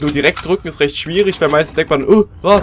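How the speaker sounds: ordinary delivery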